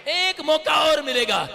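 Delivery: loud, high, drawn-out shout; slow triumphant preacher's cry; rousing proclamation. Real, not acted. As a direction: proclaim it to a whole congregation. Preacher proclaims hope to congregation.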